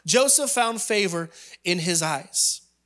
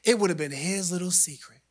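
ordinary delivery

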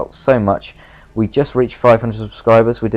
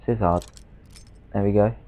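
ordinary talk, nothing marked

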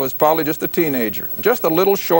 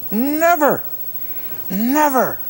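high ringing Never, then again; Never, flung high and final; emphatic never; 9 semitones higher, slower than usual. Real, not acted. Preacher rejecting a false teaching, emphatic high denial.

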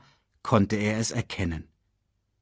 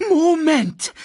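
very high, loud squawk; screeching; 8 dB louder than usual. A narrator's reading. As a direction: screech to halt them. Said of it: "kreischte Sasu".

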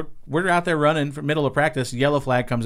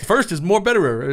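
mock flubbed quote; 8 semitones higher, 3 dB louder than usual. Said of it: "we gave him shit forever".

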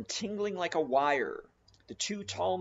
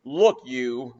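louder, threatening bellow; captain threatening the cannibal; roaring; 3 semitones lower, 8 dB louder than usual. Acted. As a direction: roar a warning. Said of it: "roared the captain".

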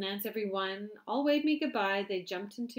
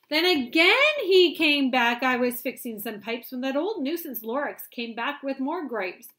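storybook narration; 6 semitones higher, 16 dB louder than usual. A narrator's reading. Parent reading The Lorax aloud, loud and lively. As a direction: tell it big for a child.

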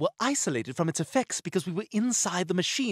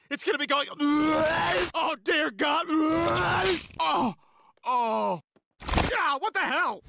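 panicked; 9 semitones higher, slower than usual. Acted. Panics about diaper.

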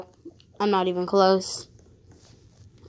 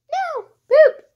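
shrill high no and poop; high squeal; 5 dB louder than usual. Real, not acted. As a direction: squeal it in dismay.

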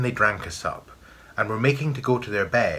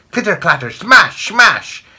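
loud, high sound-effect words; explosive high clatter; clattering crashes; 11 semitones higher, 12 dB louder than usual. A narrator's reading. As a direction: burst out each crash at high pitch. Narrator acts the crockery breaking.

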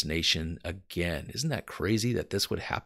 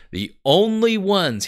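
emphatic quote; 11 semitones higher, 8 dB louder than usual. Retold or imitated. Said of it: "he said".

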